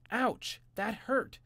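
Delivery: high, loud yelp of pain; shouted ouch. A narrator's reading. Boy hit by a cookbook yelps in pain. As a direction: cry out as the book hits. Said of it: "shouted Jimmy".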